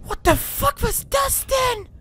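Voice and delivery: high shrieking fright; startled high scream; scared shriek. Real, not acted. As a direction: shriek in real terror at a jump scare. Jump scare in horror game.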